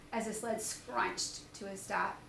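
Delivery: usual speaking voice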